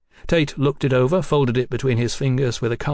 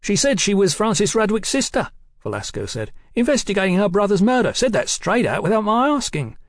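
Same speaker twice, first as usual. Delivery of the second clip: much higher-pitched informant voice; second rogue detective; 10 semitones higher, 4 dB louder than usual. Acted. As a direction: report it eagerly in a high voice.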